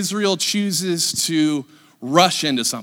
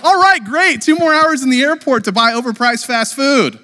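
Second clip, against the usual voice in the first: high, loud burst of fake delight; mock excited; 8 semitones higher, 5 dB louder than usual. Preacher mocks travelers cheering a delay, overexcited. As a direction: gush as if thrilled, hammed up. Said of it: "No one's excited".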